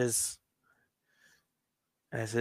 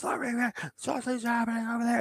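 resisting, desperate; 12 semitones higher, 4 dB quieter, much faster than usual. Retold or imitated. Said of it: "he tries to resist".

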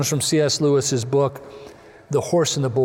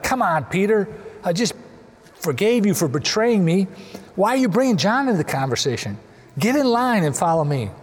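exasperated scolding, slightly higher; harsh rebuke; 4 semitones higher than usual. Shown as a demonstration. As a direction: scold a disciple with impatience. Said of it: "Sort of a harsh kind of tone".

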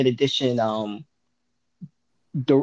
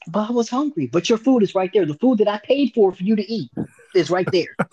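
whiny exchange; 8 semitones higher than usual. Retold or imitated. Acted quarrel, both sides.

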